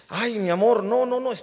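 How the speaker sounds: a husband startled as her water breaks; panicked husband. Retold or imitated. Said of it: "Yo dije".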